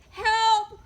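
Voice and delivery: loud high cry for help; a horse's panicked shout; cried out. A narrator's reading. Tacey horse crying out in alarm, loud high cry. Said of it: "cried the Tacey horse".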